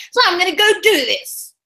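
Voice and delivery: mock fury at the world; angry outburst. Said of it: "I think I'm really angry".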